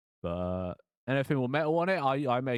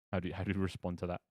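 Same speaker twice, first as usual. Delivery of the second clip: ordinary talk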